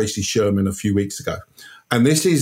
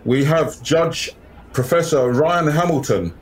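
town crier; 5 semitones higher than usual. Pretend. Formal debate announcement.

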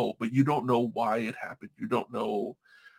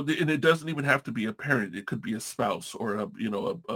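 ordinary delivery

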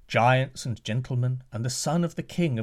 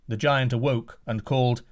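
ordinary delivery

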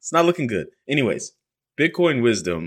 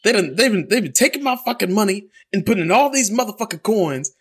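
imitating dad angry; 11 semitones higher than usual. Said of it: "And he's like".

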